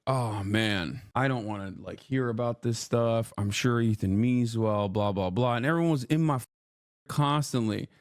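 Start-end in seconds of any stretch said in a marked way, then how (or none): 0.1-5.6 mock bored self. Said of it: "I was like".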